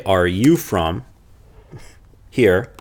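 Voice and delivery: slow "Are you from here" rising on "you"; slow rising teacher's question; question intonation. Shown as a demonstration. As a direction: ask it slowly, lifting on "you". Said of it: "question mark".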